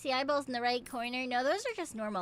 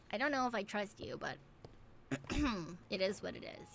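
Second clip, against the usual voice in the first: ordinary talk, nothing marked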